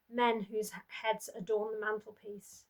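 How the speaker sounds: ordinary delivery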